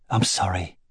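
soft, low apology; whispered apology. A narrator's reading. Husband holding his wife, hushed apology. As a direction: barely breathe the words. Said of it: "he whispered".

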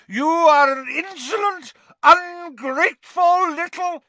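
high, loud, spluttering outrage; swelling fury. A narrator's reading. Aunt swelling up at insolent nephew, enraged. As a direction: build each insult until words fail. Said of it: "swelling with fury".

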